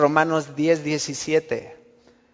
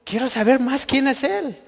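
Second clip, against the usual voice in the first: higher, breathless wish to know more; eager curiosity; 7 semitones higher than usual. Retold or imitated. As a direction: lean in, eager to learn. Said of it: "la cara de Bartimeo así de".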